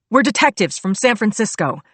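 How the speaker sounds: loud urgent shout to nearby cops. A narrator's reading. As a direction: call out the warning loudly. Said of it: "I flashed my badge and shouted".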